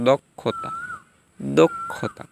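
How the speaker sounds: দক্ষতা as "dok-khota"; k-kh sound. Shown as a demonstration. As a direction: slowly split দক্ষতা at the doubled k. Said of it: "উচ্চারণ কক হয়".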